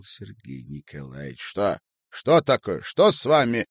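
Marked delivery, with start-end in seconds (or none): 1.4-3.7 high, loud startled questions; frightened, surprised. A narrator's reading. Man running up to crying woman, frightened and surprised. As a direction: fire out alarmed questions, growing louder. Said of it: "Голос его был испуганным и удивлённым".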